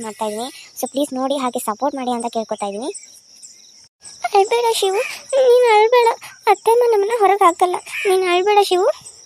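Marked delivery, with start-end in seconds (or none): 4.3-9.2 put-on high, soothing big-sister voice, gently hushing a crying child; consoling elder sister. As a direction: comfort the little brother, high and tender. Elder sister consoling little brother. (ಅಕ್ಕ ತಮ್ಮನನ್ನು ಸಮಾಧಾನಪಡಿಸುವ ಎತ್ತರದ ಮೃದು ಧ್ವನಿ)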